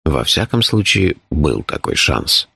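deep, composed character voice; low, measured, matter-of-fact telling; phlegmatic Shurf. A narrator's reading. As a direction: explain danger with unruffled calm.

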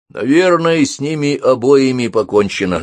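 high, loud, sinking pitch; onlookers' verdict. A narrator's reading. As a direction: call it out like a bystander in the crowd. Onlookers' gossip voiced in story. (громкий голос зеваки)

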